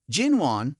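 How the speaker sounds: ordinary delivery, nothing marked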